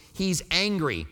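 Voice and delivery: usual speaking voice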